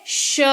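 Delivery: श held as a clear hiss with the tongue pressed; श, the palatal sh sound alone; श demonstrated as a sustained sh; sh sound demo. Shown as a demonstration. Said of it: "उच्चारण क्या होता है".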